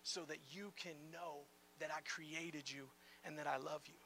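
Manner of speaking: tender God. Retold or imitated. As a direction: speak it gently, like a whispered promise. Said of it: "he made this and said".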